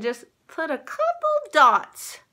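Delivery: ventriloquist talk through still lips; talking with mouth closed. Real, not acted. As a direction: speak without moving your lips. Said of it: "I'm like a ventriloquist".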